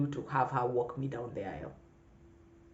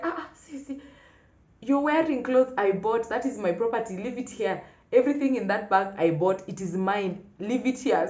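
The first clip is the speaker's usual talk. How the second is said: imitating mom, much higher and louder; scolding mom; 7 semitones higher, 5 dB louder than usual. Mom telling packing sister the clothes are hers, mock scolding. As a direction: mom stopping the runaway sister, mock stern. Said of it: "Then my mom was like".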